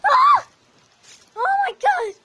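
high, loud shriek; frightened scream. Real, not acted. Scream at spider.

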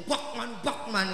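ordinary talk

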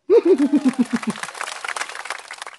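high, trailing storyteller's laugh; closing chuckle. Acted. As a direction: chuckle away after the ending.

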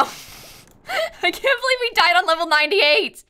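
slow, high wail of disbelief; upset, wailing disbelief; really upset. Real, not acted. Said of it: "I'm actually really upset right now".

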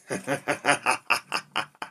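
long rapid cackle; rattling villain's laugh; gloating laugh. Acted. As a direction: laugh on and on after your threat. Gloating laugh after the threat.

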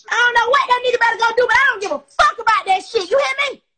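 real anger, loud and high, rushing; loud, defiant blast at a rival; angry outburst. Real, not acted. Defying a man they won't back down to, angry outburst.

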